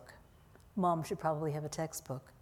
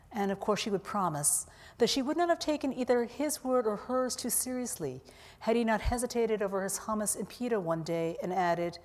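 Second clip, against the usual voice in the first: ordinary delivery, nothing marked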